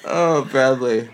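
ordinary talk, nothing marked

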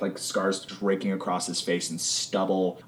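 usual speaking voice

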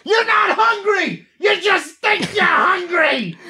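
high, slow shouted scolding; mock yelling. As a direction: bellow it like a drill sergeant. Yelling at pretzel-eating cohost.

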